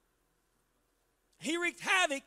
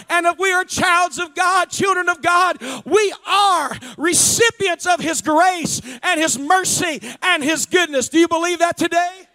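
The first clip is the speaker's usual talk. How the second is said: full-force preaching; 2 semitones higher, 11 dB louder than usual. Acted. Pastor lifting up his congregation, full-force preaching. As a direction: build it up and press every line home.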